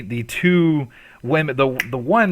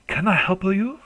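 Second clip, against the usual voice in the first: lightly voiced little old lady, higher; a hint of a frail shop grandmother; 8 semitones higher than usual. GM as old grandmother greeting customers, lightly voiced.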